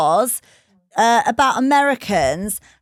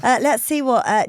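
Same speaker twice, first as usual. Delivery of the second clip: ordinary talk, nothing marked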